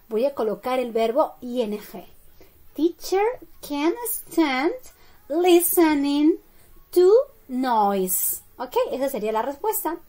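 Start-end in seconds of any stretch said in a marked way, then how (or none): 2.7-8.4 very slow, word by word with pauses; teacher spelling out the answer for learners; slow model sentence. Shown as a demonstration. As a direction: dictate it in stretched-out chunks. Teacher gives the full answer.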